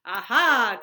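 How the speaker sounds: loud knowing aha; cried. A narrator's reading. Wonka crying aha to Grandpa Joe. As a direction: crow it out. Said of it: "cried Mister Wonka".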